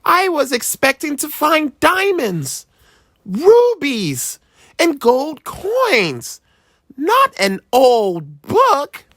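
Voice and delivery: put-on high boy voice dragging with letdown; slow, sulky kid complaint; disappointed child. A narrator's reading. Boy complaining to his dad, disappointed child voice.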